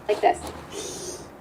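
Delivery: slushy lateral lisp on "like this", air escaping over the sides; lateral lisp demo. Shown as a demonstration. Speech therapist demonstrating a lateral lisp to parents. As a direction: let the air spill sideways on "like this". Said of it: "or lateral".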